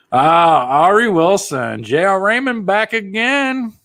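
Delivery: high, loud, drawn-out announcer-like call; long high holler of welcome; hailing greeting. Real, not acted. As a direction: hail them loudly, stretching the names. Hails returning viewers by name.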